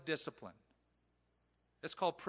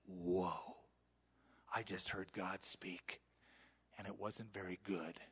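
awed whisper; 8 semitones lower, 8 dB quieter than usual. Retold or imitated.

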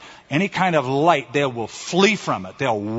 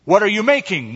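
high, raised-voice question; louder shout; 7 semitones higher, 5 dB louder than usual. A narrator's reading. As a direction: call it out loudly, without a full shout. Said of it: "one shouted even louder".

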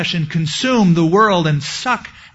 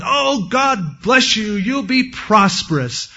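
high, drawn-out gushing blessing; mock false prophets; 6 semitones higher, slower than usual. False prophets promising the people prosperity, mock gushing. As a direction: gush it slowly, pitched way up. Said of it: "this other group of prophets were always going".

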